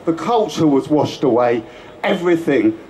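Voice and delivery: usual speaking voice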